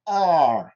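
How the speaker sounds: R as a long, curled "ar" without any trill; English R. Shown as a demonstration. Teacher modelling the English letter for French learners, untrilled R. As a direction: hold the English R, tongue never tapping. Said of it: "Il faut essayer de prononcer ce R".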